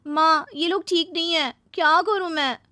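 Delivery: high, plaintive daughter's voice; high-pitched, troubled pleading; distressed complaint. Acted. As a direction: appeal helplessly to your mother. Daughter complains to her mother.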